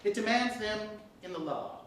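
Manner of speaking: ordinary delivery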